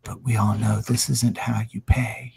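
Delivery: low, soft menacing threat; menacing villain. Acted. Whispered close to his ear.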